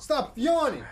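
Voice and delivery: high, sharp call-out; playful shout. Real, not acted. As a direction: yell it at them, half laughing. Scolding a yawning friend.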